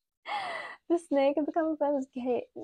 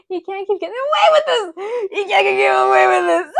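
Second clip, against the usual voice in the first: high shouted wail, building louder; hammed-up outrage; 5 semitones higher, 8 dB louder than usual. Streamer in mock outrage at chat, building to a shout. Acts out a meme outburst.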